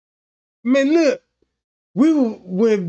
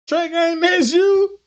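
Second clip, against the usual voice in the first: much higher startled question; surprised worker; 6 semitones higher, slower than usual. Retold or imitated. Worker recognizing Trey Gang, much higher surprised voice. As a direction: perk up high in recognition.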